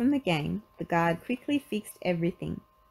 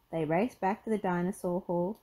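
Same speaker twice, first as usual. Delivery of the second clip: plain reading voice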